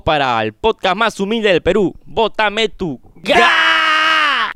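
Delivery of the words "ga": high, long-held "gaaa" shout; long catchphrase yell. Pretend. Donation reader bellowing the show's catchphrase, long high yell. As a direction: belt the syllable out and hold it.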